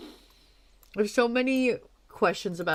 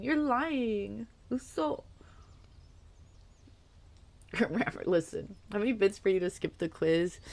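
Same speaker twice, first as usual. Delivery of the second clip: ordinary talk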